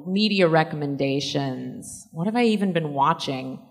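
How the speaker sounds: ordinary talk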